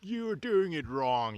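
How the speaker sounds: high, slow sneering critic; mocking critic voice. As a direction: whine it like a know-it-all critic. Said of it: "I have a lot of people to".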